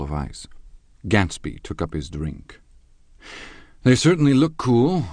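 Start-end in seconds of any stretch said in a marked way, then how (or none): 3.8-5.0 higher, louder strained small talk; visibly tense. A narrator's reading. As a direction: force the polite remark through nerves. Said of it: "with visible tension".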